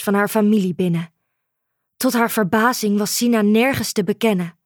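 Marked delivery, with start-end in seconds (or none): none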